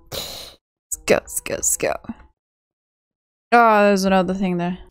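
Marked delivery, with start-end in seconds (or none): 0.1-1.9 whispering. Real, not acted. Urging under breath.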